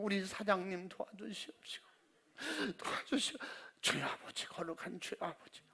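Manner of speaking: 숨소리로 속삭이듯 기도; 긴장해서 소리 죽여 중얼중얼; whispered prayer. Retold or imitated. (속삭이는 기도)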